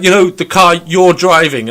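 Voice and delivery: over-explain each word; guard talking down about the car; patronizing slow talk. Retold or imitated.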